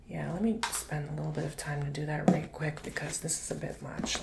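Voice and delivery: ordinary delivery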